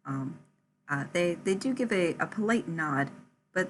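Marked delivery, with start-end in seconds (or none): none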